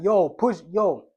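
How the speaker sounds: loud, sharp call to push up; goalkeeper's shout. Pretend. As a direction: yell the order fast. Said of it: "He's gonna be like".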